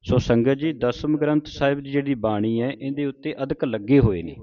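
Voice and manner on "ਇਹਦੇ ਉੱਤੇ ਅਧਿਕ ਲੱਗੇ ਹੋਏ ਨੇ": ordinary talk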